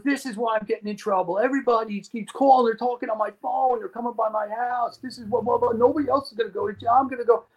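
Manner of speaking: high, relentless imitation of an agitated man; retold paranoid rant. Dealer ranting about callers to his house, agitated tirade. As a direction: run the complaints on, pitched well up.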